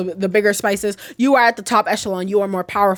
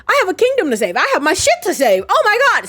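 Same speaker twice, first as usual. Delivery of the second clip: higher, building indignant rally; worked-up outburst; 9 semitones higher, faster than usual. Retold or imitated. Captured heroine has her kingdom to save, worked up. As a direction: mock-desperate cry rising at the end.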